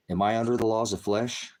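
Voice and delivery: ordinary talk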